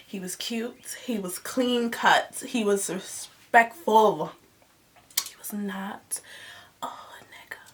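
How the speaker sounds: hushed gossiping whisper; breathy confidential whisper. Real, not acted. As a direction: lean in and whisper the secret. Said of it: "whispered gossip aside".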